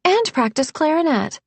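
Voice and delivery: high-pitched straight-faced add-on; deadpan. A narrator's reading. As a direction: tack on the excuse innocently, pitched high.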